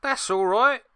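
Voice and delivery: high, loud Cockney "That's all right"; cheery London lad brushing it off; Cockney shrug. Acted. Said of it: "who apparently is Cockney".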